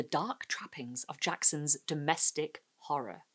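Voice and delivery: hushed, slow whisper; spooky whisper. Shown as a demonstration. Host teasing the horror to viewers, whispered. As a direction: breathe the words like a secret. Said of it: "host hushes for horror effect".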